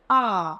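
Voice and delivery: आ stretched long and clear; long drawn aa. Shown as a demonstration. Teacher showing pupils the long आ vowel. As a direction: draw out the आ sound. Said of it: "वो लंबी खींची जाएगी".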